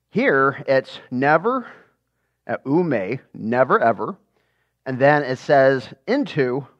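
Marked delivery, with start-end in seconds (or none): none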